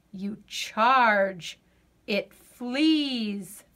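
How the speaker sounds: usual speaking voice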